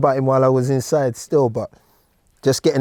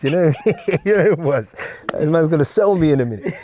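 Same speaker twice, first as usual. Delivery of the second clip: laughing while talking; 6 semitones higher, faster than usual. Real, not acted. Laughing at audition memory.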